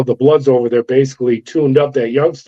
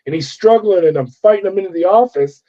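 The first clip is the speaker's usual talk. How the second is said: ordinary talk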